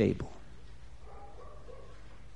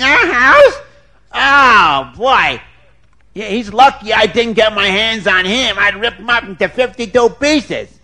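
a hint of a gruff brute, louder, incredulous; angry husband; 6 semitones higher, 6 dB louder than usual. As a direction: bluster like a brute about the intruder. Husband Schwarzer Wolf.

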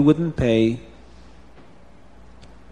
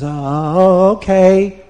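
drawn-out, louder, rising assent; reluctant agreement; 6 semitones higher, 6 dB louder, much slower than usual. A narrator's reading. Farmer's wife giving in to his plea, reluctant. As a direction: give in hesitantly, stretching the words.

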